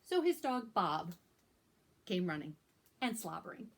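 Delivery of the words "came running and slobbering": plain reading voice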